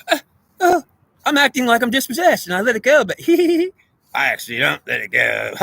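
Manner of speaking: high, giggly mock Pelosi voice, breaking into a laugh; mocking Nancy Pelosi. Pelosi confessing to Trump, hammed-up high giggly voice. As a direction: play her as a sly giggling pretender. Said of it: "She says".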